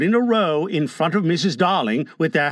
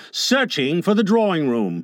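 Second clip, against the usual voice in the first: usual speaking voice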